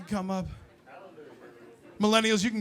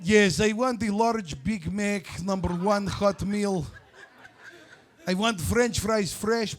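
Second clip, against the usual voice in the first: put-on slow Russian-accented order; 3 dB quieter than usual. Customer ordering at the drive-thru, mock Russian accent. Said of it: "put on some fucking voice".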